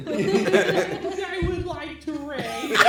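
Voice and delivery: slow, high silly cartoon voice; Carl Weezer voice. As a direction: drag it out in a goofy cartoon voice. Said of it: "I wanna see a Carl Weezer voice".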